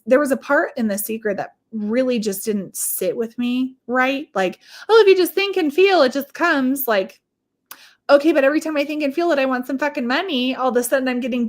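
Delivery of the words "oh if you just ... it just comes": high, loud airy parody of easy advice; mocking singsong. Mocking the Secret's promise in a high, airy voice. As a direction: sing it out like a naive self-help tape.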